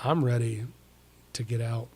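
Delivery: usual speaking voice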